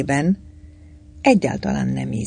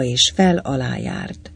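plain reading voice

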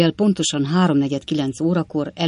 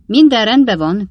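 cheerful call, a bit higher and louder; shouting; 5 semitones higher, 8 dB louder than usual. A narrator's reading. Bob Sawyer calls out cheerfully from the doorstep. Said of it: "kiáltotta Bob Sawyer úr".

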